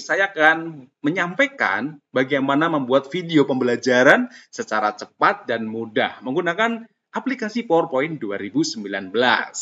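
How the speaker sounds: ordinary delivery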